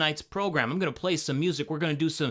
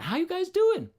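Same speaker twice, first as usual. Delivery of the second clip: bright sing-song hello to viewers; excited greeting. Real, not acted. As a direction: pitch jumps way up on the greeting. Host greeting live stream viewers.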